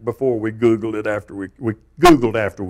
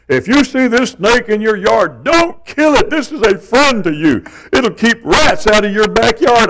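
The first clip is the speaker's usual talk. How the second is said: higher, louder preachy nature lover; mocking know-it-all post; 10 semitones higher, 5 dB louder than usual. Pastor mocking an online post, preachy high voice. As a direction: mimic a smug snake advocate, pitched high. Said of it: "somebody posted one the other day".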